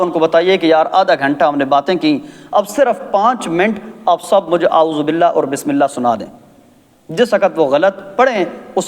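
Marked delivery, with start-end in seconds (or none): none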